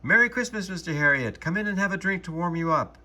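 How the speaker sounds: loud, high, cheery call across the street. A narrator's reading. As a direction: call out a festive greeting from the doorway. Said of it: "I heard her call out".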